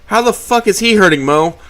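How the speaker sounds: loud high outrage; angry. A narrator's reading. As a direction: demand it furiously. Trish outraged.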